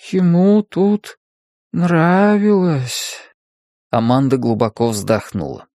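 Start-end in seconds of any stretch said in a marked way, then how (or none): 0.1-3.2 very slow, higher; dreamy sister voice. A narrator's reading. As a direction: drift off. Sleepy sister answers.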